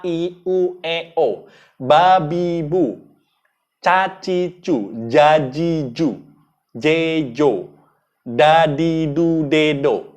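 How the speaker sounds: vowels and syllables I, U, E, O, ba, bi, bu each sounded out crisply and separately; articulation drill. Shown as a demonstration. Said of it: "Latihannya bisa Anda A".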